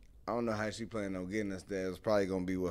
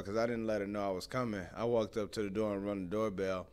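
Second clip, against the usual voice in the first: ordinary talk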